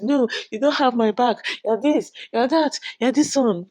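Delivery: high-pitched protesting retelling; mock indignant. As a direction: push him away, imitating her complaints. Retold quarrel, she rejects him.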